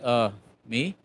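small voice, caught out; sheepish reply. Retold or imitated. Answering the clerk who demands who's in charge, sheepishly and softly.

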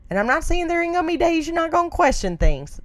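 ordinary talk, nothing marked

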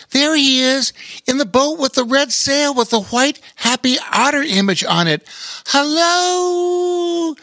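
put-on high excited kid calling out; a boy yelling from the ramp; young boy voice. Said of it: "yelled Rowan".

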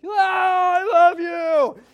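high, loud, slow gushing yell; over-the-top Peter blurting his love; overexcited yell. Retold or imitated. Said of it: "he's just yelling".

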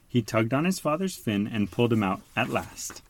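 ordinary delivery

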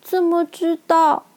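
音调很高、迟疑停顿的小女孩; 怯怯地发问; 战战兢兢. A narrator's reading. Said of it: "她战战兢兢地提出自己的疑问". (战战兢兢地问)

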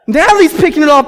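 normal talking voice

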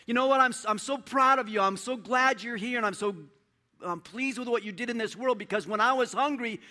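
loud, high, joyful voice of the King; the Lord praising his sheep at full preaching force; Jesus praising the faithful. Acted. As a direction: proclaim it as a proud, delighted King. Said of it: "he talks to the sheep and he says this".

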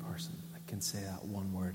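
hushed whisper. Real, not acted. Pastor confiding to congregation in a whisper. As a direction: lean in and whisper it. Intimate aside about partner.

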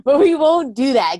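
high and loud, still laughing. Real, not acted. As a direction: laugh through it. Laughing after a shared joke.

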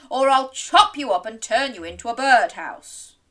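loud, forceful threat; bellowed. Acted. Woodcutter threatening the wolf, loud bellowing. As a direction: bellow the threat with punched words. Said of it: "bellowed the woodcutter".

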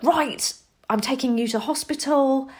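a hint of a dad rushing to act; dramatic dad. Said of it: "is quite dramatic".

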